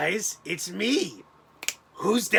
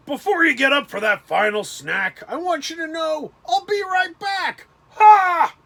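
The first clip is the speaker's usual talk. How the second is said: higher, louder puppet-alien voice ending in a shout; bad Alf impression for the stream; 8 semitones higher, 8 dB louder than usual. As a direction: ham up the sitcom alien, then bark the laugh. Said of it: "Let me do the Alf impression".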